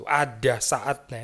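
drawn-out, deliberate "Ada saatnya"; slow preacher's emphasis. Acted. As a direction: stretch "Ada saatnya" slowly for weight. Preacher repeats key phrase slowly.

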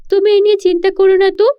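eager daughter. Acted. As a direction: rush to brush off her worry. Daughter reassures mother.

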